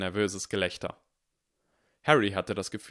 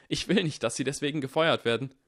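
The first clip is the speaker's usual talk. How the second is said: higher, fast, anxious rush; blurted in fear; 6 semitones higher, faster than usual. A narrator's reading. Harry pleads with his teacher, frightened and rushed. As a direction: get the words out before the fear wins. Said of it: "stieß er aus".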